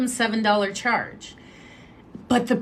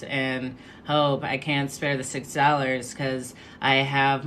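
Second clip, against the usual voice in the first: much lower, even, droning voice with no lift; monotone friend; 8 semitones lower, 5 dB quieter than usual. Retold or imitated. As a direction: drone out every excuse on one low note. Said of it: "she speaks in this very monotone voice".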